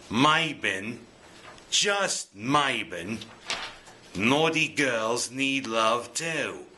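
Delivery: slow, bad Cockney accent; half-hearted Cockney accent singalong. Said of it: "that's a bad Cockney accent".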